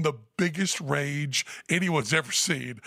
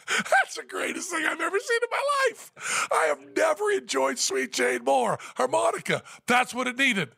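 wildly overexcited fan; gushing delight; 12 semitones higher than usual. Retold or imitated. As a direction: gush like the best night ever. Gushing praise to furious Lou.

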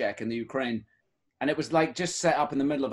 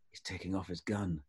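low, much softer murmur; hushed warning; 7 semitones lower, 10 dB quieter than usual. Retold or imitated. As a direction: warn him under your breath. Friend whispering a warning at dinner.

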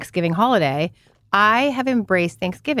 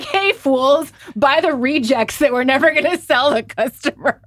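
much higher, louder sneering voice of condescending bosses; mocking bosses; 7 semitones higher, 3 dB louder than usual. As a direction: lampoon the employers with a laugh.

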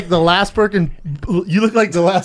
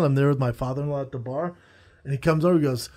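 ordinary talk

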